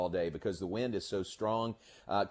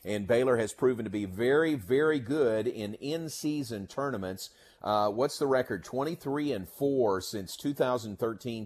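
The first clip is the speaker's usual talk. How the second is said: ordinary talk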